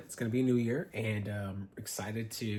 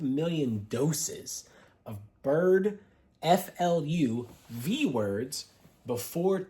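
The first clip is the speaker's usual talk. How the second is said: spelled out slowly; 2 semitones higher, much slower than usual. Shown as a demonstration. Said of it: "I'm gonna have to use certain language here".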